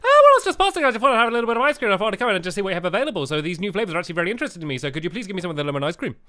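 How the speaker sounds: rushed, very fast customer patter; speaking really fast. Acted. Customer orders ice cream as fast as physically possible. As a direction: rattle it off as fast as you can. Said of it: "speak really fast".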